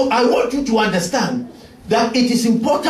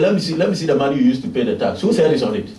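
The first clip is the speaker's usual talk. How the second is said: brisk Jesus; 6 semitones lower, much faster than usual. Retold or imitated. Jesus asking about the coin.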